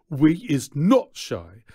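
pompous, emphatic protest; indignant retort. A narrator's reading. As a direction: push it out loud and affronted. Clarence P retorts to showman.

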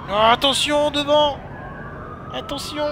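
very high, loud cry of warning; alarmed shout. Real, not acted. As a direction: scream a warning at whoever's ahead. Obstacle devant la voiture. (cri d'alarme très aigu)

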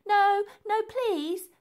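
high, loud frightened begging; pleading. Acted. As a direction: beg urgently for it to stop. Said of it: "pleaded Elsie".

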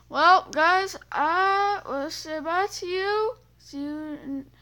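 high, loud, slow sign-off; exaggerated bubbly farewell; cheery outro. Real, not acted. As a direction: bid the viewers a big goodbye. Signing off the video.

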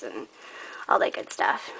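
breathy whispered aside; whispering. Real, not acted. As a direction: drop to a whisper. Excited whisper about secret passages.

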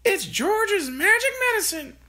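put-on high, loud old woman's cry; Grandma shouting. A narrator's reading. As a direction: crow it out as the grandmother. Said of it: "Grandma shouted".

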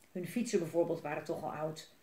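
ordinary delivery

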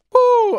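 high, loud psyched-up whoop. Real, not acted. Player gearing up for a new tactic, loud whoop. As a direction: let out a big high "woo".

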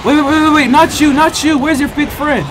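normal talking voice

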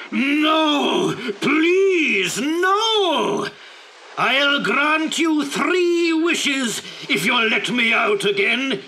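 high, loud pleading shouts; desperate genie. Acted. Trapped genie begging Abdullah to open the bottle. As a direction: beg and bellow at once.

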